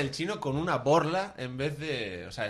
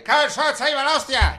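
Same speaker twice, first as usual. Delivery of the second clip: imitated angry Basque; 10 semitones higher, 7 dB louder than usual. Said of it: "se ha puesto el otro ahí".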